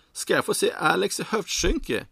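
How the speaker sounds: high, loud, drawn-out squeal of delight; happy shout. A narrator's reading. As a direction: cry out slowly, thrilled to bits. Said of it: "ropar han lyckligt".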